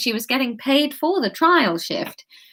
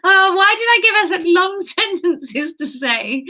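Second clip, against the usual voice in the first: breaking into giggles mid-sentence; laughing; 8 semitones higher than usual. Real, not acted. Reader cracking up at her own script, laughing. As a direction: laugh through the words, higher.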